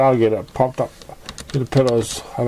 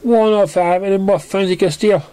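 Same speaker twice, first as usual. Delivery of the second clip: accusing Pinkie; 9 semitones higher, 4 dB louder than usual. A narrator's reading.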